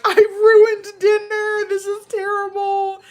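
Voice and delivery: high, slow, whiny mock sob; mock crying. Wife wailing to husband over ruined dinner, high whiny mock sob. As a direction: wail it like a hammed-up crybaby. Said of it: "I start crying".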